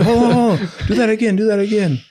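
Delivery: high, loud excited call. Retold or imitated. Coach calls to a recruit to repeat the dunk, excited. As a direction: hype the kid up to go again.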